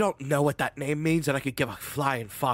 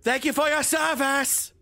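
high shout; 11 semitones higher than usual. Acted. Prisoner bolts shouting thanks.

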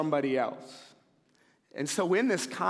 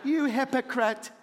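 mock accusation; 8 semitones higher than usual. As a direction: throw it at him half-laughing. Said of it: "you're thinking in your seat".

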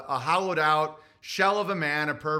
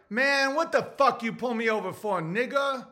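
street attitude; 5 semitones higher than usual. Retold or imitated. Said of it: "If you're like".

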